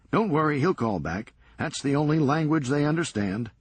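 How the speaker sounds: brisk, sardonic comeback; wry Serge. Acted. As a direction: toss it off dryly, quick. Serge to friends.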